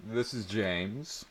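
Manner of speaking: slow, held-back normal tone; forced calm. A narrator's reading. Boy calling the girl, forcing a normal tone. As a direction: hide the excitement, keep it level. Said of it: "maintaining a normal voice tone".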